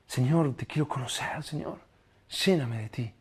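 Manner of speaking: ruego muy suave y bajo; pídelo casi en susurro, rendido; oración susurrada. Acted. Oración íntima citada. (oración íntima en voz muy baja)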